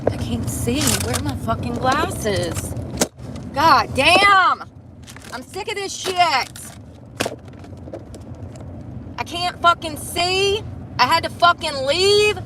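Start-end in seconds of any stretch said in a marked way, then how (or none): 3.5-12.4 cursing, pitch jumping up, frustrated; exasperated morning rant, voice climbing; fed-up outburst. Real, not acted. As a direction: let the frustration burst out high.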